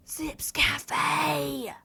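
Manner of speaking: breathy excited exclamation; excited yell. Real, not acted. Streamer discovers what the gift is, excited yell. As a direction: cry out the surprise gift's name. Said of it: "I'm sorry I yelled into the mic".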